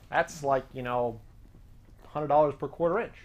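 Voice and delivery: usual speaking voice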